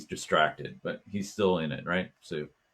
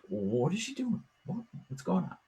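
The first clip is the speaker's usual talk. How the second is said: puzzled classmates; 11 semitones higher, 5 dB quieter than usual. Retold or imitated. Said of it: "they're like".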